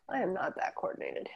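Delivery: ordinary talk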